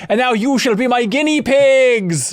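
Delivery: bad German accent. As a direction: imitate a crazy scientist with a poor German accent. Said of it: "I can't do a German accent".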